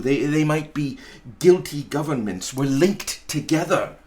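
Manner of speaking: ordinary delivery, nothing marked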